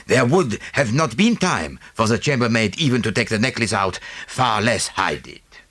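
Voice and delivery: put-on lightly French-accented detective; a hint of Poirot's French.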